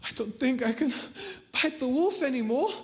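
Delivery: high, faltering scared voice; acted fright. Boy realizing the wolf is really there, acted fright.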